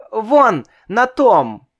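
high, loud eager shout; eager call. A narrator's reading. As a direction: point and call out. Child points, calls out.

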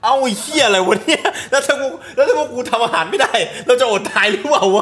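loud, high-pitched indignant protest; comic outburst. Real, not acted. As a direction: protest loudly in mock outrage. Comic outburst at friend's answer.

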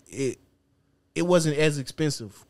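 usual speaking voice

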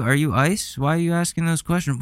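ordinary delivery

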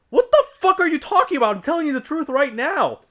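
retold outraged denial, fast and high; indignant outburst. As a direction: fire it back at the accuser in disbelief.

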